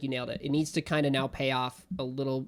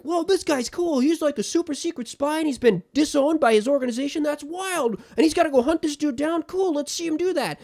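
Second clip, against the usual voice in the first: much higher, louder gushing fan; mock hyped viewer; 13 semitones higher, 6 dB louder than usual. Mocking a viewer hooked by a pilot, high and gushing. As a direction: gush like a wide-eyed first-time viewer. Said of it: "your first episode is absolutely like".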